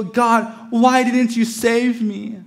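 high, loud drawn-out complaint; accusing wail. Acted. We blame God after driving off the cliff, loud mock wail. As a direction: wail it upward in mock reproach. Acted blaming cry to God.

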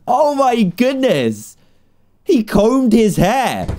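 blown away by the cutscene; amazed. Real, not acted.

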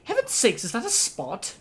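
high fussy teapot lady voice; Mrs Potts voice. Retold or imitated. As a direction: squeal it like a fussy housekeeper. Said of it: "And then Missus Potts comes in".

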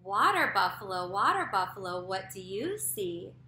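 slow, loud sing-song read-aloud; reading to a toddler. A narrator's reading. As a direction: read it slow and bouncy for little kids. Reads picture book aloud to kids.

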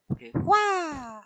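high drawn-out startled wah; sudden squeal of alarm; startled wail. Real, not acted. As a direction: let out a slow, high yelp of alarm. Startled while dragging object.

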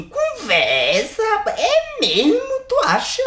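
high, mocking squeak of disbelief; sarcastic disbelief. Priest mocking the nun's obvious conclusion with high sarcastic disbelief. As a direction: scoff at her like she's joking. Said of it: "dizer assim".

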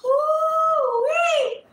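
high, loud ooh wee; excited whoop. Real, not acted. Reader squeals at the harsh cut-off card. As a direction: let a loud squeal of delight out. Reacting to the cards.

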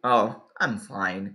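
low, slow, reluctant "oh, I'm fine"; not really fine. Shown as a demonstration. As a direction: say you're okay when you really aren't. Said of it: "mas na verdade". ("I'm fine" arrastado, de quem não está bem)

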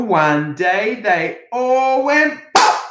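slow, drawn-out storybook finish; storytime reading. A narrator's reading. Finishing a story for children in slow storybook voice. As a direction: land the last line for the kids, slowly.

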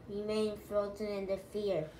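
ordinary delivery, nothing marked

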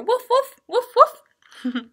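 high, loud little woofs; dog woofing. Acted. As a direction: woof it like a dog.

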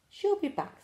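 put-on high hopeful baby owl; bright little owlet reassuring, high. A narrator's reading. Eldest owl telling siblings mum will return, high.

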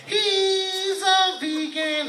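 very high, stretched singsong; hammed-up, long-held high vowels; mock rich voice. Re-enacting his joke in mom's voice.

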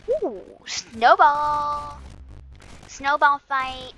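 high, drawn-out excited cry; delighted battle call. Real, not acted. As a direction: stretch the words out, loud and high. Calling out excitedly in game.

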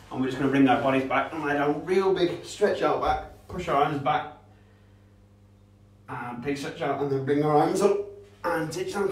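put-on kids' fitness instructor guiding a warm-up; upbeat PE coach cues. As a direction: coach the stretches with energy. Leads a kids' warm-up stretch.

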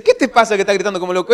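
fast, high, loud exasperated question; mock scolding. Wife asking husband why he is yelling, mock-exasperated. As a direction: imitate a baffled wife telling him off.

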